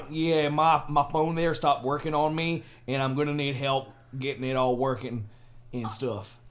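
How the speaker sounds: slow southern caller channelling an inner Papa; drawn-out folksy southern accent. Pretend. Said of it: "why is your southern accent [name]".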